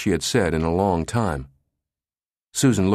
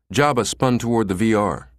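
ordinary delivery

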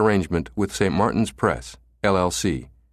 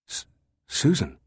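stuttering over her name; stammering; 6 semitones higher, 3 dB quieter than usual. A narrator's reading. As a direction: stammer in shock. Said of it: "he stammered".

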